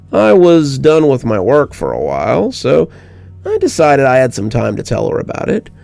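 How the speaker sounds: ordinary delivery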